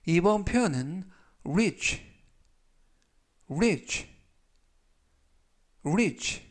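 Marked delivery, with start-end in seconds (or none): 1.4-6.4 reach said slowly and clearly, three times; 또박또박 천천히 reach 발음 시범; slow demo pronunciation. Shown as a demonstration. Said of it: "라고 발음하시면 되겠고요". (천천히 또렷하게 읽는 발음 시범)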